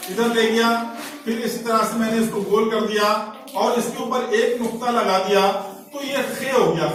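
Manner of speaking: normal talking voice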